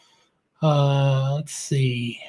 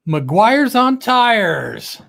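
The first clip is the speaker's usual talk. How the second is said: very slow, higher, louder name shout-out; drawn-out welcome call; announcer call-out; 5 semitones higher, 4 dB louder, much slower than usual. Acted. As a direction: stretch out the channel name like a ring announcer. Host calls out viewer's channel name.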